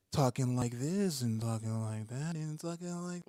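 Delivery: high, slow, sing-song speech; melodic talking demo. Shown as a demonstration. Rap teacher shows melody in the voice, sing-song. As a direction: swoop the pitch up and down as you talk. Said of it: "the melody in your voice".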